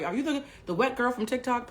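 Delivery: usual speaking voice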